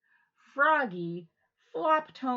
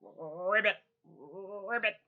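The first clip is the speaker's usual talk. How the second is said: a lightly croaked ribbit; frog croak; 2 semitones lower, slower than usual. Pretend. Teacher leading kids in a mild frog croak. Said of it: "sound like a frog".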